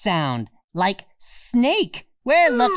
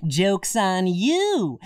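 normal talking voice

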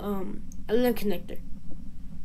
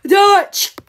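loud, higher single-word yell; shouted cry; 4 semitones higher, 7 dB louder than usual. Acted. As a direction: yell it out sharply. Calls out during shooting.

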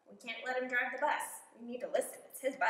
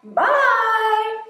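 high loud bye; bus driver; 11 semitones higher, 16 dB louder than usual. Acted. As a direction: call it out leaving. Driver leaving.

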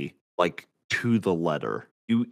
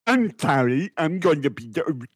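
mock mic voice; 5 semitones higher, 5 dB louder than usual. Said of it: "it's like".

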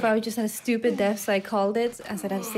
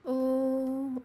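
ordinary delivery

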